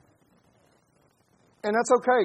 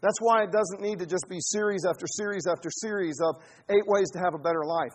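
ordinary talk, nothing marked

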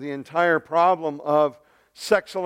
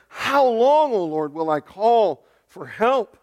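louder, higher cry on "How long"; 5 semitones higher, 4 dB louder, slower than usual. Acted. Prophet long praying to the Lord, crying out. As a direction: plead it loudly, stretching out the call for help. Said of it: "Habakkuk's been praying about for a while".

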